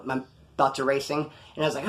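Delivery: normal talking voice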